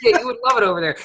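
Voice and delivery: words through real laughter; laughing. Real, not acted. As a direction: laugh while answering. Laughing at friend's joke.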